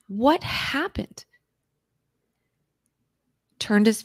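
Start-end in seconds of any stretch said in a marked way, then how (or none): 0.1-1.2 drawn-out, slow repeat of the question; slow pleading question. Retold or imitated. Pressing her friend again, slow and pleading. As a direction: stretch each word, begging for an answer.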